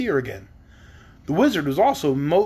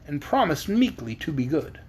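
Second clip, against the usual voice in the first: usual speaking voice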